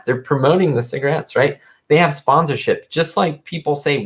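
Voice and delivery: ordinary talk, nothing marked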